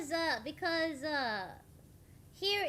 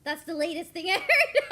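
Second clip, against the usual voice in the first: loud, laughing admission; helpless giggle swallowing the end; breaking into laughter; 6 semitones higher, 8 dB louder than usual. Real, not acted. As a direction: crack up on the last word. Laughing at her own habit.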